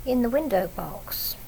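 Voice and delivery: ordinary delivery, nothing marked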